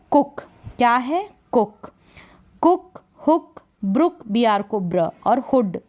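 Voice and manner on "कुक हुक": "कुक, हुक" with short u, pitched up. Shown as a demonstration. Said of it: "इस तरीके से".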